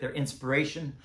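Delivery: ordinary talk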